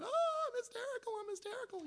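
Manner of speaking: high, squealing mock hysteria; mock hysterics. Preacher mocking movie hysterics, squealing high mock panic. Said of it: "those movies where somebody's hysterical".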